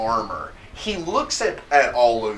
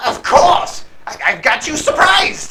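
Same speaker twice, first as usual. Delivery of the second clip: high, loud, stammering boast; blustering kobold bandit; 12 semitones higher, 6 dB louder than usual. Acted. Bandit insisting to the party he can win, high bluster.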